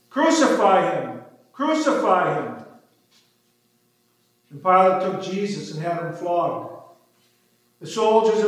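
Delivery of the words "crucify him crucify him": ordinary delivery, nothing marked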